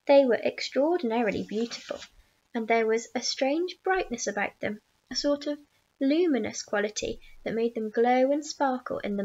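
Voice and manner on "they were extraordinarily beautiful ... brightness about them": ordinary delivery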